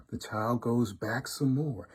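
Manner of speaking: plain reading voice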